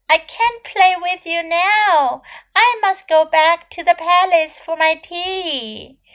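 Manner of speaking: high, loud dismissive princess; a spoiled princess brushing him off; cartoon princess. Princess refuses the frog.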